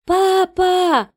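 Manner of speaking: high, loud scream for help; Катя, screaming. A narrator's reading. Daughter to her father under attack, terrified scream. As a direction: cry out shrilly for your father. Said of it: "Катя закричала".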